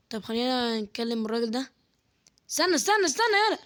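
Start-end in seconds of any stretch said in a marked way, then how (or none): 2.5-3.6 high, urgent "wait, wait". Real, not acted. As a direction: call out to stop someone, pitched way up. Frantic in-game moment.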